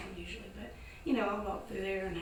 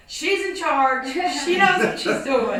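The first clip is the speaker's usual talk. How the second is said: shout from a window; 6 semitones higher, 17 dB louder, slower than usual. Retold or imitated.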